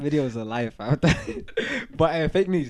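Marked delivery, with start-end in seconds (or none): 1.0-1.9 squealing giggle; high-pitched laugh. Real, not acted. Laughs after denying video.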